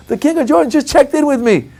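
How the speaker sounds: high, loud breathless brag to wife; bragging excitement. Retold or imitated. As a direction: boast to the wife. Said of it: "I go".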